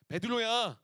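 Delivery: 베드로야 cried out warmly and loud; calling out. Retold or imitated. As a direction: call 베드로야 across to him, full voice. Jesus calls out to Peter.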